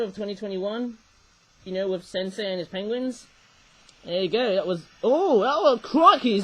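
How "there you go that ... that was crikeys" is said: ordinary talk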